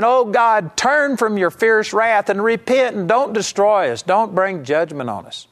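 high, insistent imitation of begging God; mock pleading. Preacher imitating believers begging God to spare them, mock pleading. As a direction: act out a fervent plea, easing at the end. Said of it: "you're pleading and saying".

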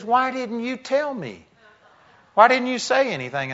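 high-pitched bewildered friend; excited disbelief. Retold or imitated. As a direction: voice him leaping up in amazed hurt. Friend asks why never told.

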